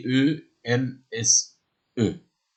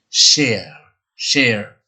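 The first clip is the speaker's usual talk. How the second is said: American accent; 5 dB louder than usual. Shown as a demonstration. Teacher demonstrating the American share for learners. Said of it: "l'américain, vous allez entendre le mot, le son R".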